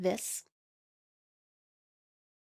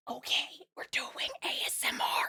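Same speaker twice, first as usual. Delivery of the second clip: whispering; 6 dB quieter than usual. Pretend. Jokingly starts an ASMR segment.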